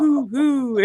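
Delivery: high mock owl hoot, hoo hoo. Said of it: "there's owls in the trees going".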